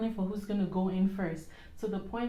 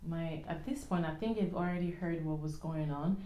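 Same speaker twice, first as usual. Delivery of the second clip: normal talking voice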